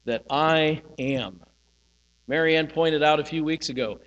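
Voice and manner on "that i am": slow, separate weight on "I" and "am"; emphasized phrase. Shown as a demonstration. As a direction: spell out "I am" slowly, word by word. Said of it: "you can emphasize different things".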